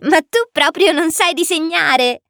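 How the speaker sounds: high little prince voice, teasing; amused exclamation. A narrator's reading. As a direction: exclaim in a child's high voice, just after giggling. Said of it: "esclamò". (esclamazione divertita con vocina acuta)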